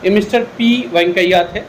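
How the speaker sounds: ordinary delivery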